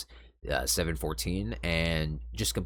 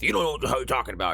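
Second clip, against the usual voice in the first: put-on bratty kid comeback; 6 semitones higher, 3 dB louder, much faster than usual. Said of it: "as a child, you would try to fight back with".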